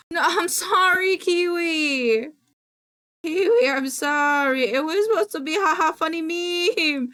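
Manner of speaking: high, whiny wail of regret; pleading apology. Real, not acted. Streamer to the timed-out viewer, pleading. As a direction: wail your excuse apologetically.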